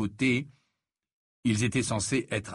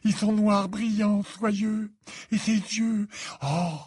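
high, dreamy rapture; lovestruck swooning; 12 semitones higher, 3 dB louder, slower than usual. A narrator's reading. Ron raves to Harry about her, swooning. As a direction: swoon over every detail in a much higher voice.

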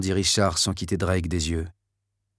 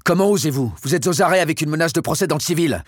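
furious outburst; 9 semitones higher than usual. Acted. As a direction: explode at the lieutenant, rising in fury. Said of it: "Le général McKenna explosa".

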